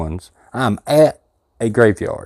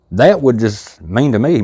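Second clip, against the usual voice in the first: usual speaking voice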